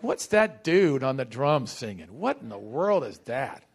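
low, dismissive mock puzzlement; mock bafflement. Preacher imagining himself without the Spirit hearing a drummer, mock bafflement. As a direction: drop the pitch way down and play the unimpressed onlooker. Voices a baffled, unspiritual reaction to singer.

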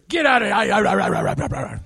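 slow, curt brush-off; parodying an unkind churchgoer to a stranger; mock rude dismissal. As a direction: play a rude man shooing a stranger. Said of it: "Or I treat a stranger".

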